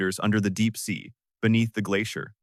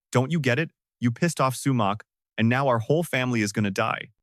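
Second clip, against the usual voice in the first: ordinary delivery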